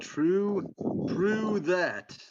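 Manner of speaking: hammed-up Jersey accent. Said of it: "is that your Jersey accent?".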